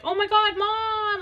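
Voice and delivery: imitating her son's high-pitched delight; excited kid. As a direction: squeal it like a kid spotting his mom. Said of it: "he was like".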